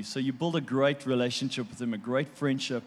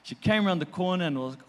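ordinary delivery

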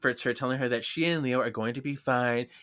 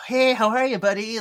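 put-on bright high greeting to a child, a touch louder; cheerful greeting; 8 semitones higher, 5 dB louder than usual. Leo greeting a small boy, high and cheerful. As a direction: coo at the kid like a friendly uncle. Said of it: "He's doing this, like".

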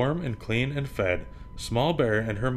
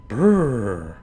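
shivering brrr; 6 dB louder than usual. Acted. Bear shivering in cold.

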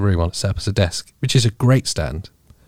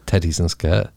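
"teddies and skirt" in a Scottish accent; 4 semitones lower than usual. Shown as a demonstration. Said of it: "that sounds Scottish to me".

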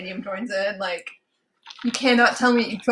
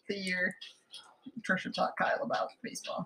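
whispering; 3 semitones lower, 12 dB quieter than usual. Real, not acted. Hushed aside about personal story.

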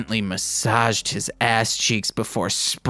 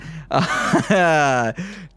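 higher, drawn-out giggle; laugh; 6 semitones higher than usual. Real, not acted. Reader cracks up after acting a line, giggling. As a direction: laugh at your own silly performance. Laughing at her own performance.

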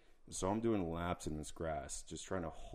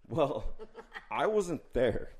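laughing; 9 semitones higher, 7 dB louder, slower than usual. Real, not acted. Laughing at own story.

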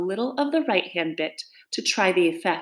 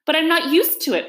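high, plaintive protest; piteous pleading; 10 semitones higher, 4 dB louder than usual. Acted. Alice pleads with the caterpillar about her height, piteous. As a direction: plead like a hard-done-by girl. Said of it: "pleaded poor Alice in a piteous tone".

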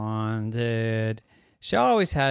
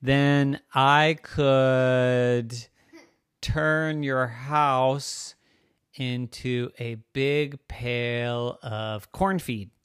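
very slow, drawn-out offer; slow, deliberate suggestion; pondering cow; 2 semitones higher, 6 dB louder, much slower than usual. Acted. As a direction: stretch each word while making it up. Cow slowly thinks up another offer.

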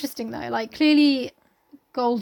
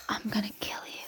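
whispered threat; 12 dB quieter than usual. Pretend. Co-host playing a killer threatening Jack, whispered menace.

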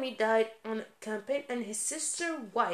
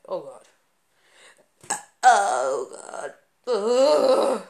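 loud disgusted oh God, ugh; disgusted groan; 11 dB louder, much slower than usual. Real, not acted. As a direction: recoil loudly in disgust. Disgust at gruesome detail.

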